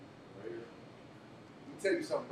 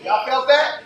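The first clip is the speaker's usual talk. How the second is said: loud, higher call-out to the room; crowd call; 8 semitones higher, 14 dB louder, slower than usual. Real, not acted. Host asking the crowd if they felt it, loud call. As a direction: rouse the audience.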